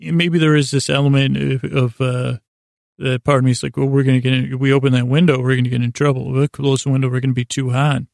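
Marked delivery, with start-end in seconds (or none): none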